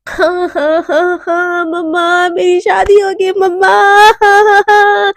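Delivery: hammed-up fake sobbing, rising into a wail; playful pretend weeping at her own wedding; mock crying. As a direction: fake a crying fit for mom, overdoing it. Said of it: "नाटक करके रोने लगती है".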